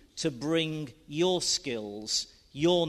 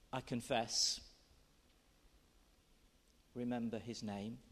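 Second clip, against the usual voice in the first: quiet reflective poem reading; 4 semitones lower, 6 dB quieter than usual. Acted. Reads poem aloud.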